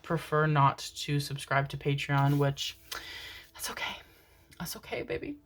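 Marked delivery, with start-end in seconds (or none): 3.5-5.4 hushed and soft, reassuring; soft whispered reassurance; soothing whisper. Acted. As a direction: whisper it like comforting a baby. Soothing the viewer who won't subscribe.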